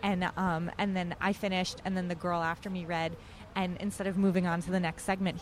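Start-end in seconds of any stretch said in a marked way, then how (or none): none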